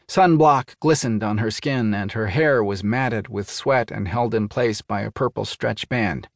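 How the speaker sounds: ordinary delivery, nothing marked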